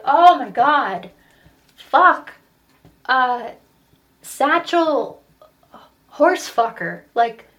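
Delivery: loud, high exasperated outburst, acted out; frustrated child. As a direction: play a kid at wit's end, shouting wrong guesses. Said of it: "And I got so frustrated".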